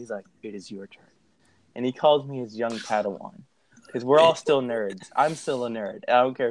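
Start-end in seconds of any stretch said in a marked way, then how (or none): 0.4-1.0 low, very soft sage voice; hushed mock-mentor gravity; Morpheus-Yoda mixture. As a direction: intone it deep and quiet. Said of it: "he sounded like a mixture of Morpheus from The Matrix and Yoda".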